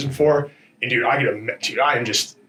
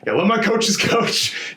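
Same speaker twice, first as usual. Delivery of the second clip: laughing; 9 semitones higher, slower than usual. Retold or imitated. Retells telling a friend off.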